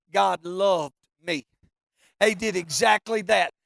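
ordinary talk

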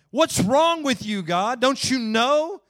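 high, loud, slow demand at God; exasperated cry. Acted. A worn-out believer loudly demanding answers from God. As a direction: hurl the question upward, fed up. If you wait long enough.

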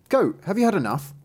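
high, lightly acted youth's voice; a hint of a young lad calling to an animal; youth questioning goat. Eldest son asks the goat at night, lightly voiced youth.

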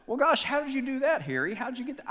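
high, puzzled doctor; playing the bewildered doctor. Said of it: "And the doctor said".

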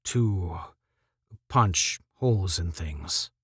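low, hushed and slow; almost a whisper. A narrator's reading. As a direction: drop to a soft, awed near-whisper. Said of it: "his voice was almost a whisper".

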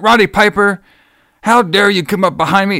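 high-pitched accusing imitation; mock indignation. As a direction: accuse a rival in hammed-up outrage. Acting out wrestler's outraged accusation.

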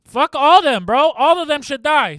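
loud, high rant; yelling. Real, not acted. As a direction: shout it out, worked up. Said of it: "why are you screaming".

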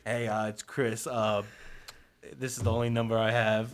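mopey voicemail voice. Retold or imitated. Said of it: "he's like".

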